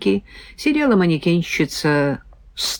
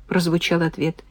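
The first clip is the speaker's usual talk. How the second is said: ordinary delivery, nothing marked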